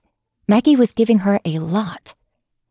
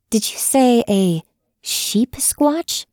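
slow disbelieving question; incredulous; 5 semitones higher, much slower than usual. A narrator's reading. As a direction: spell out the absurd word. Maggie thinks she misheard.

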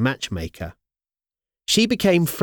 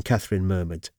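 usual speaking voice

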